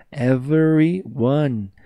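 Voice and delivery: everyone stretched very slow; drawn-out model word. Shown as a demonstration. Teacher drilling the class, very slow. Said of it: "model word drawn out".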